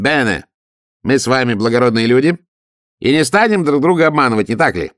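бене с итальянским «е», голос выше; граф чуть приподнято, «бене» с итальянским «е»; бене, мы с вами благородные люди. A narrator's reading. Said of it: "наконец сказал он". (граф льстиво приподнят, «бене» на итальянский лад)

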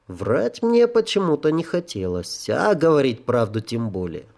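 ordinary delivery

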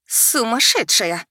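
slow, loud furious gasp; choking with rage. A narrator's reading. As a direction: choke on your anger. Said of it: "захлёбываясь гневом, выдохнула Агая". (захлёбываясь гневом)

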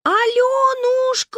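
very high, slow, loud sing-song call; thin voice call. A narrator's reading. Manya calls the girl in a thin high voice.

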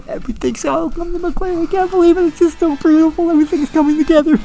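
mock rapture. Mock gushing over the ending.

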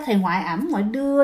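ordinary delivery

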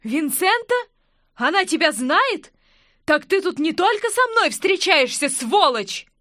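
high, loud jealous outburst; furious woman accusing her lover; angry. A narrator's reading. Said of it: "гневно уставилась на любовника".

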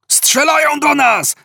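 very high-pitched alarmed yell; panicked scream. A narrator's reading. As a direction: shriek it curled up under fire. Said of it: "wrzasnął zwinięty w kłębek Artur". (przerażony, piskliwy wrzask)

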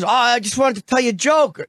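lightly voiced Russian guy calling someone over; Russian handyman. Handyman calling to a passer-by, lightly imitated. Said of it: "he was, like, a Russian guy".

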